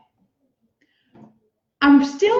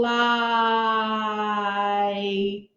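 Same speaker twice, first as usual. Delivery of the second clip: one long stretched-out "Like", spoken low to oneself; said slowly; 4 semitones lower, 4 dB quieter than usual. Shown as a demonstration. Teacher sounding out a sight word slowly before writing it. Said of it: "I'm gonna say it slowly to myself".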